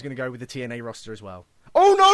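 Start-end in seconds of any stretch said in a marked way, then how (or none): 1.7-2.2 high, loud hammed-up cry of dismay; mock dismay. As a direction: play it as a fake disaster.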